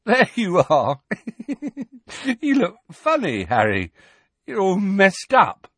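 high, giggly, dazed voice; giddy Ron. A narrator's reading. Ron grins dopily at Harry, high and giggly. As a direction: giggle through it, woozy and high. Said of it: "still giggling feebly".

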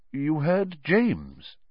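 slow, stunned question; slow stunned question of an old friend; strange voice. A narrator's reading. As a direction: caught off guard by the name. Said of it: "said Lupin in a strange voice".

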